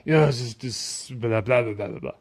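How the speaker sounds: a lightly voiced sneering atheist brushing off the experts; dismissive singsong mimicry that fades into blah blah; mocking impression. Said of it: "say you're wrong".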